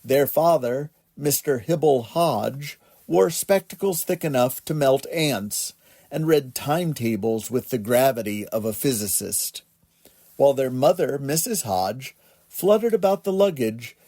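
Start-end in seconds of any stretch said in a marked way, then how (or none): none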